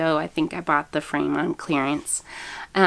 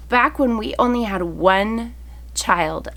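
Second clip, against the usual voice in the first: ordinary talk, nothing marked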